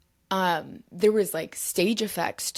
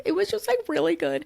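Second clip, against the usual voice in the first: flustered; 12 semitones higher, faster than usual. Retold or imitated. Retold flustered answer to actor.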